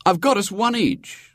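put-on high, cheery boy's voice; cheerful. A narrator's reading. William bringing his seasick mother doughnuts, cheerfully. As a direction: announce it cheerfully, pitched well up. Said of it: "said William cheerfully".

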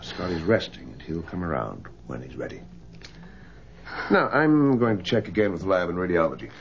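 normal talking voice

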